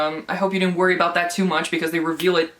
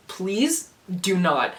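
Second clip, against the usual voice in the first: ordinary delivery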